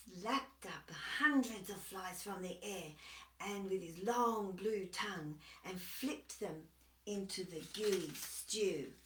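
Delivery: ordinary delivery, nothing marked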